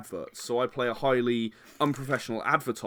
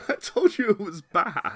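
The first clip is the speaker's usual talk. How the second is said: laughing protest; 7 semitones higher, slower than usual. Real, not acted. Laughing after failed accent attempt.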